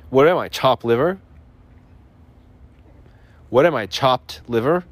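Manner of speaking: chopped said with a hard, clearly released T; crisp T in chopped. Shown as a demonstration. Host demos his favorite phrase, crisp enunciation of chopped. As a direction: spit out the T in chopped. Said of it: "put a lot of emphasis on your enunciation".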